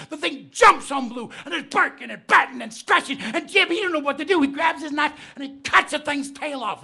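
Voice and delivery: loud, high action telling; building excited narration. Acted. Storyteller driving the fight with the creature, loud and high. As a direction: ramp up the fight scene.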